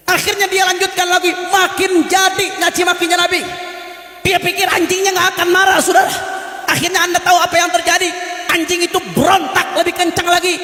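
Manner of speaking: fiery preaching. Acted. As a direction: stir the crowd to anger, full force. Preaching at full force. (khotbah penuh amarah, tinggi dan keras)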